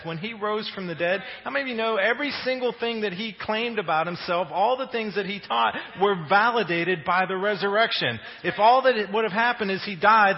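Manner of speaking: loud, rising preacher's build; full-force preaching. Acted. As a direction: preach it out to the room.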